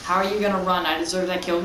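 normal talking voice

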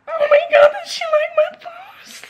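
high, loud squealing sob of disbelief; crying excitement. Real, not acted. Fan seeing her post shared, loud tearful shriek. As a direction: shriek it through real happy tears. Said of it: "I'm almost fucking crying".

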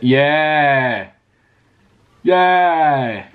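drawn-out, delighted yeah; a long, triumphant whoop of yeah; celebratory cheer. Real, not acted. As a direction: cheer it, stretching out the vowel. Celebrates after getting the watch working.

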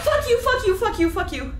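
high, loud shocked cursing; angry cursing. Real, not acted. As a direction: swear at the monster. Cursing at the jump scare.

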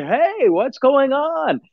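mock Kai greeting. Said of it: "and he's like".

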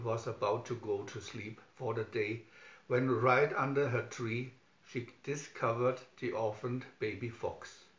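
usual speaking voice

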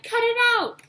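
high, loud protest; furious indignant cry; angry shout. A narrator's reading. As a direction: yell at the ghost to stop. Ash being thumped by Gengar.

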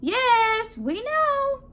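loud, high chorus shout; shouting back. A narrator's reading. Donuts answer baker's call, cheerful shout. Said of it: "the donut shouted back".

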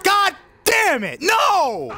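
high, drawn-out furious shout; enraged scream. Real, not acted. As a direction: scream it out in real despair. Rages at a setback in game.

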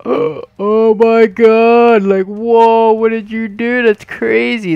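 high, loud, slow gasping disbelief; a character freaking out in loud high shock; shocked outburst. Pretend. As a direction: blurt it out in stunned amazement.

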